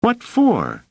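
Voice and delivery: put-on high, indignant kid's question; squeaky, protesting boy voice; struggling boy. A narrator's reading. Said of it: "panting and struggling".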